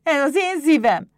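high, loud possessive imp cry; indignant kobold. A narrator's reading. Pumukli glaring at Éder, high angry shout. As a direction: claim it like a furious little goblin. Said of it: "Villámló szemmel nézett Éderre".

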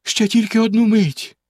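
high, begging wife; pleading. A narrator's reading. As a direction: plead for a moment. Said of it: "благально мовила дружина". (благально)

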